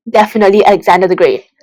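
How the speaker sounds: ordinary delivery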